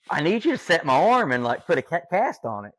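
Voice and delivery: loud, high-pitched urgent plea; exasperated plea. Retold or imitated. Patient to crying nurse about setting his arm, exasperated plea. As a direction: raise your voice in exasperated insistence.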